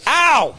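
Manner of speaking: sudden high "ow"; real startled cry of pain; pained yelp. Real, not acted. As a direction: yelp as if hit in the leg. Hit in the leg mid-sentence.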